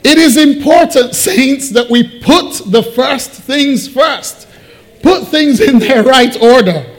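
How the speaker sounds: high, loud, emphatic exhortation; forceful preaching. Real, not acted. Preacher urges saints to put first things first, forceful. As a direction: proclaim it to the congregation, leaning on key words.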